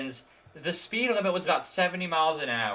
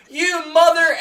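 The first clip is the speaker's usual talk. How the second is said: mock yell; 11 semitones higher, 7 dB louder, slower than usual.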